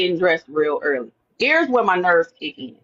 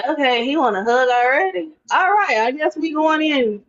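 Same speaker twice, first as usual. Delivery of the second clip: mock surprised; 8 semitones higher than usual. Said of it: "And I'm like".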